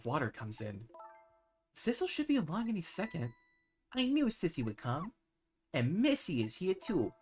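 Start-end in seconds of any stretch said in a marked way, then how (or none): 1.8-7.1 put-on higher, happy drowsy girl; light little-girl voice, content; high young girl. Camilla glad everyone is here, higher drowsy girl voice.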